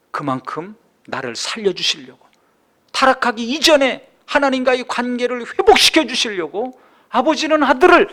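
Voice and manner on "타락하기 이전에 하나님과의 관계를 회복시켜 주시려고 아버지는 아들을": raised, forceful sermon voice; impassioned preaching. Acted. Preacher explaining the Father giving his Son, impassioned. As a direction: build higher and louder toward the last words.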